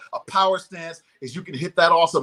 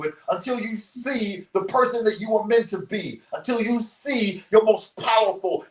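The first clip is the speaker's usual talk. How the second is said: raised, energetic coaching voice; motivational speaker guiding; 7 semitones higher than usual. Acted. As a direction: lead the whole room to their feet. Leads audience in soul selfie.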